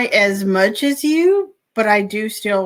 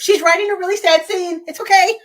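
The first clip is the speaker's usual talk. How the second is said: reassuring bystanders; 9 semitones higher, 5 dB louder, faster than usual. Retold or imitated. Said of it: "I was like".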